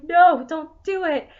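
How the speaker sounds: loud drawn-out plea; pleading shout. Retold or imitated. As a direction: beg them to stop, loudly. Pleading with the character.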